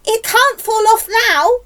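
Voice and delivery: high, loud announcement; excited shout. A narrator's reading. Aunt sees peach resting on ground, excited shout. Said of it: "Aunt Sponge shouted".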